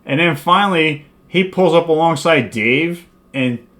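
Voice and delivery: ordinary talk, nothing marked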